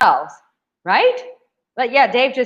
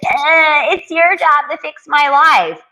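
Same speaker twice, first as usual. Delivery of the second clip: high, louder put-on whine; whining; 4 semitones higher, 5 dB louder, slower than usual. Mocking a woman who whines about life. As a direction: nag like a spoiled complainer. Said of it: "constantly whining and bitching".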